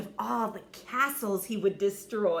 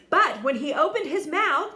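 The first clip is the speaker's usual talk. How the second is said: suspense build; 7 semitones higher, 9 dB louder than usual. A narrator's reading.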